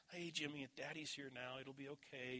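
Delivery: put-on soft, gentle hush to a crying child; calm him down quietly, like a dad at the crib; soothing softly. Father soothing his crying toddler in recovery, soft and gentle.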